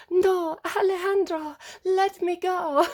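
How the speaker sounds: put-on high, slow, dramatic [name]-style accent with a pleading tone; sing-song the plea high and slow in a Gaga accent. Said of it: "I'm sorry to do the accent".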